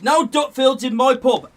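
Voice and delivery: loud, firm ban; loud barman. Retold or imitated. Barman banning Dutfields from his pub. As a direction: say it like a stern landlord. Barman's line first time.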